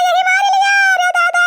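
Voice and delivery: mild mock wail; whimpering plea. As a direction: cry out that you've been beaten enough. Old woman pleads after beating.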